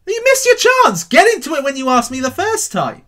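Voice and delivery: loud, high scolding outburst; exasperated telling-off. Real, not acted. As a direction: tell them off sharply. Scolding those who asked late.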